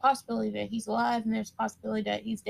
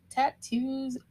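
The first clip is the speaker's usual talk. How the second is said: ordinary delivery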